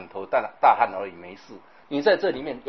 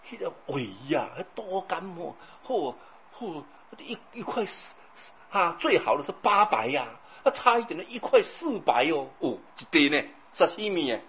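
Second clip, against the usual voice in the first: mildly awed foodie, hushed then higher; imitating gushing neighbour; 5 semitones higher than usual. Neighbour raving about the Pingtung fish, imitated awed gushing. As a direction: marvel over a delicacy, hushed at first, then rising. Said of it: "他就跟我讲说".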